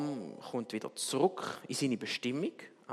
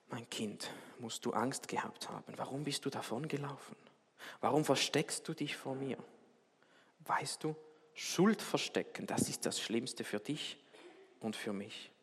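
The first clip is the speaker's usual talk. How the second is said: zärtlich tröstend; 7 dB quieter than usual. A narrator's reading. Vater tröstet das gefundene Kind.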